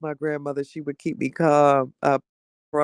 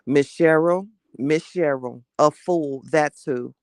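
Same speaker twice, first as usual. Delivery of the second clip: ordinary delivery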